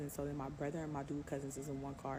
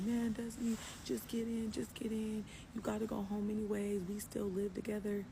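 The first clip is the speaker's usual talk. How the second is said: higher-pitched imitation of a coaxing man; lightly voiced pleading ex; 5 semitones higher than usual. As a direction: mimic him urging her to get in. Retells ex coaxing her into car.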